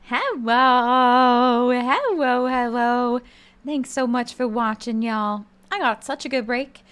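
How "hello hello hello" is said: loud greeting. Real, not acted.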